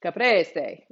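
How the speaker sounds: caprese in an Italian accent, tapped R; Italian pronunciation. Shown as a demonstration. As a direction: say caprese as if in Italy. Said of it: "if I was in Italy".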